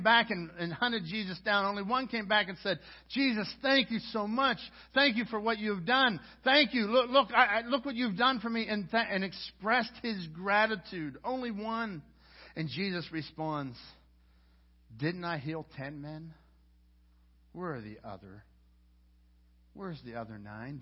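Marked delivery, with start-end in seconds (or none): 3.1-8.7 higher, louder thanks; grateful Samaritan. Acted. 15.0-20.8 a quiet, wounded question; Jesus asking sadly. Acted. Jesus voiced, lament.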